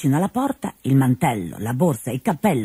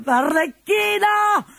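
father yelling at the servant chatting with his daughter; angry old man's shout; 13 semitones higher than usual. Acted. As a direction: bellow at the lazy servant. Pantalone shouting.

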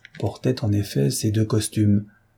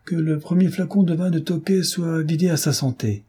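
high-pitched rousing toast, lightly projected; the duke's exclamation, raised in pitch more than volume; exclaimed toast; 7 semitones higher than usual. A narrator's reading. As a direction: propose a toast with lifted pitch. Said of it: "s'écria le duc de Chartres".

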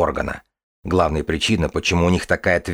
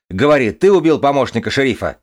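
angry demand; 7 semitones higher, 5 dB louder than usual. Acted. Father demands an answer.

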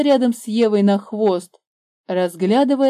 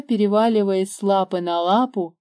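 ordinary delivery, nothing marked

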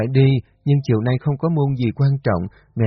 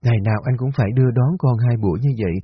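ordinary delivery

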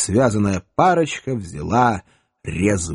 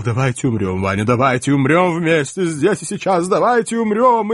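theatrical pleading; 6 semitones higher than usual. Acted. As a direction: beg him to die together. Elf begs dramatic suicide.

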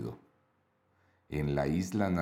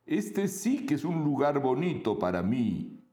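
friendly monster voice; 8 semitones higher than usual. Acted. Monstruo habla en isla rosa.

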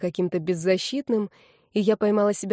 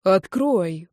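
plain reading voice

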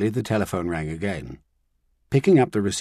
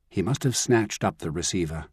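ordinary delivery, nothing marked

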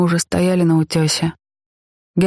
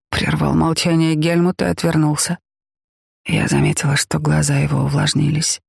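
ordinary delivery

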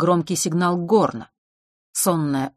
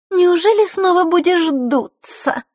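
very high, loud, slow taunt; nasty smirk; 6 dB louder, slower than usual. A narrator's reading. Girl mocks a sulking boy, nasty and high. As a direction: tease with a nasty little smirk. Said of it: "гаденько усмехнувшись".

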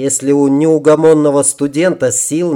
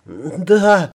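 higher, mildly quavering yes; lightly acted tremble in a raised pitch; trembling voice; 5 semitones higher than usual. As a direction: answer like a shaken, injured woman. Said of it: "дрожащим голосом ответила женщина".

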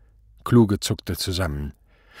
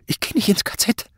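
very high, strained, half-voiceless cry; panicked refusal; 13 semitones higher than usual. A narrator's reading. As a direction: squeal the refusal in terror. Terrified man refuses the camp. (panisch, gepresst hoch)

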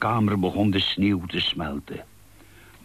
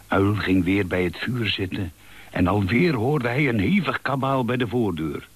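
plain reading voice

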